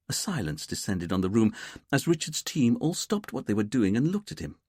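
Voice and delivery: plain reading voice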